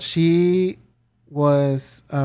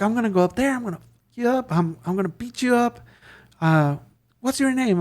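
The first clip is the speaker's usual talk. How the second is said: higher-pitched imitation of a threatening caller; mock threat; 7 semitones higher than usual. Caller threatening to beat up Fernando, mock threat. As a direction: play the angry caller, lightly. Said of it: "he's like".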